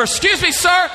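high-pitched, indignant protest; alarmed nurse. Retold or imitated. Nurse protesting to dad knocking people over, high alarmed call. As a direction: imitate an alarmed nurse calling out. Bystander objecting to running dad.

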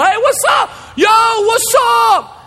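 high, loud mock call across a crowd. Friend calling across the room, loud high greeting. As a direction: hail a buddy from far off.

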